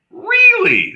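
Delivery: high squeaky "Really?"; sarcastic high-pitched disbelief; mock surprise. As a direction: leap way up in fake amazement. Mock surprise at heckler's claim.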